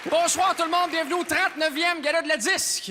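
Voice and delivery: big, loud, high-pitched show opening; rousing awards-show welcome; gala host announcing. Real, not acted. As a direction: project each name to a cheering hall.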